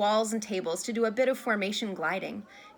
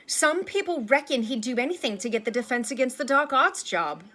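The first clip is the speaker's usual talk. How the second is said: higher, louder, reckless run-on; plunging recklessly on; 4 semitones higher, 5 dB louder than usual. A narrator's reading. Harry telling Lupin the rumor about Snape, plunging recklessly on. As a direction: push on through the whole accusation. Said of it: "then plunged recklessly on".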